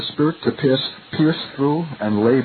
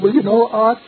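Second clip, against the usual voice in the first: high-pitched cracked voice; 8 semitones higher than usual. Retold or imitated. Youngest member correcting his elder, high-pitched cracked voice. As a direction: imitate an awkward youth's high, strained pipe. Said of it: "in his high-pitched voice and cracked voice".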